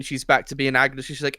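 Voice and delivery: usual speaking voice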